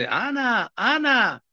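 high-pitched mock yell, drawn-out name; retold calling out. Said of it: "I just yelled".

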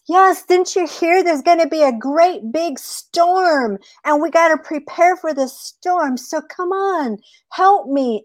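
very high, insistent rabbit voice; urgent rabbit. Acted. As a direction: rope the coyote in with high-pitched urgency. Rabbit urges coyote to prepare.

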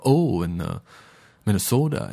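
put-on playful Minnesota accent, rising on "Minnesota"; a light Upper Midwest accent with a long "oh". Podcast host mimics how Midwest relatives talk, in Minnesota accent. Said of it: "I find myself talking like they do".

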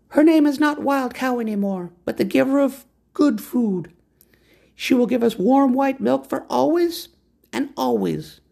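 high, proud woman's voice; lofty woman naming the horse. A narrator's reading. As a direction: announce the horse's new name.

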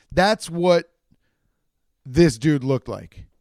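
normal talking voice

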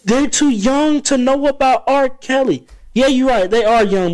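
high, slow mocking voice. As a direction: parrot the young listeners. Mocking listeners' objection.

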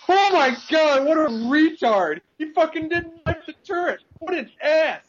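very high, loud outraged gamer impression; mock teammate yelling. Teammate berating a player over a missed knife, high mock yelling. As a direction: screech it like an incredulous teammate. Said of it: "He's, like, yelling at his friends".

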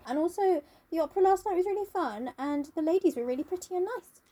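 high, airy imitation of blithe chatter; oblivious Dorian.

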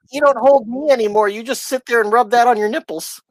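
mock wife. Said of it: "She's like".

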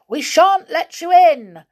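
high, loud yell; yelled defiance. A narrator's reading. Joe yelling at the bears outside the door. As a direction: shout defiance through the door. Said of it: "yelled Joe".

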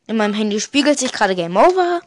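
ordinary talk, nothing marked

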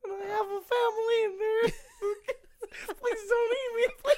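high, pleading, tearful little shrimp. Retold or imitated. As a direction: beg for your life in a squeaky voice. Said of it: "the shrimp from Shark Tale".

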